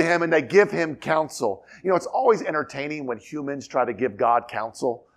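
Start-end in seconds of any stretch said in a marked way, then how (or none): none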